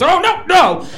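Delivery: high, loud panicked oh no; panicked yelp. Retold or imitated. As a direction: yelp as you start to fall.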